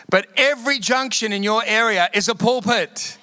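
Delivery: high, fervent pulpit delivery; rally the congregation; preaching. Acted. Pastor urges the congregation to preach, fervent and high.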